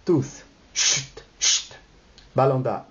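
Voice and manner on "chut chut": whispered shushes; two separate hisses for silence; hushing. Acted. Guests silence each other before the music, hushing.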